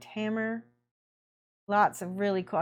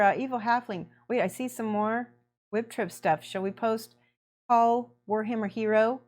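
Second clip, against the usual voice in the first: ordinary talk, nothing marked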